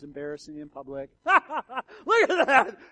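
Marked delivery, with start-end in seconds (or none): 1.2-2.8 bursting out in a loud, high laugh. Shown as a demonstration. Pastor showing his embarrassing public laugh, loud and high. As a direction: show them how you laugh too loud. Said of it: "laugh too loud sometimes".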